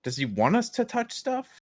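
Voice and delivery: high, disbelieving question; incredulous. Real, not acted. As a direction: ask it in total disbelief.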